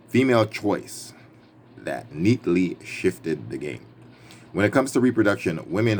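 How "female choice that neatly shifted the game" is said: slow emphasis. Real, not acted. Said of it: "Listen up".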